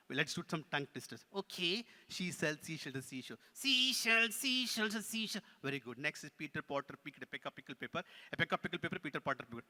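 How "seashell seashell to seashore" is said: higher-pitched puppet voice; puppet recital. Pretend. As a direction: parrot the tongue twister as the puppet. Puppet repeats tongue twister.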